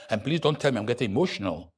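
ordinary delivery

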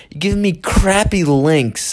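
normal talking voice

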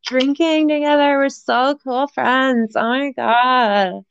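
high, drawn-out party gushing; gushing drunk friend. Acted. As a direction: slowly gush like a tipsy new best friend. Acting out drunken new friends.

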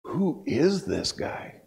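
slow, hushed wonder; retold awe, drawn out; awed. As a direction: draw it out softly, amazed. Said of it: "I sat there in awe".

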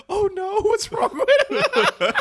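very high, slow, laughing; laughing quote. Retold or imitated. Quoting his wife's worried question while laughing, very high. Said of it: "it was like".

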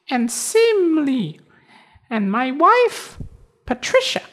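very high tiny cricket voice. Acted. Cricket introduces family.

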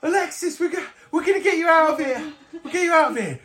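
very high, hurried promise; high-pitched, anxious determination; urgent rescuer. Acted. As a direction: reassure a captive friend urgently. Character promises captive friend rescue.

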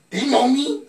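high loud repeat. Acted. Repeats louder, higher.

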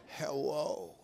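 low, soft drawn-out hello; groggy phone answer. Retold or imitated. Man answering the early morning call, groggy. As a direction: pick up as if half asleep. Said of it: "answering the phone like something's wrong".

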